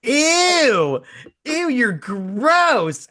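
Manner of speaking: high, slow laughing ew; grossed-out laugh. Real, not acted. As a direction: squeal it out, teasing and disgusted. Reacting to a friend's pole class.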